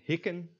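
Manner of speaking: "hikken" with short ih, weak ending; short ih then schwa in "hikken"; lax I. Shown as a demonstration. Teacher reads example word, lax ih in "hikken". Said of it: "these words are pronounced".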